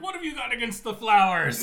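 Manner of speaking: high, loud, laughing heckle; mock crowd heckler. Crowd questioning the preacher.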